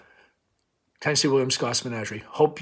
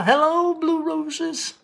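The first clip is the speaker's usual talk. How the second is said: hollered greeting; 4 dB louder, much slower than usual. Retold or imitated. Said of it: "he'd holler".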